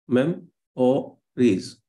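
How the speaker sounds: slow, separated "Mem-o-ries" in three beats; teacher spelling out the rhythm of "Mem-o-ries"; syllable by syllable. Shown as a demonstration. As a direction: pause between each syllable of "Mem-o-ries", weight on the first. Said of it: "you have three syllable".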